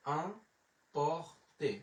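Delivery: emporter drawn out very slowly in separate syllables; slow teaching demo of emporter, piece by piece. Shown as a demonstration. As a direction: break emporter into its sounds with long gaps. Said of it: "তাহলে উচ্চারণ হলো".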